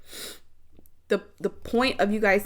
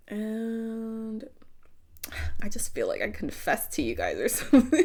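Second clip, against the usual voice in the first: laughing whisper. Real, not acted.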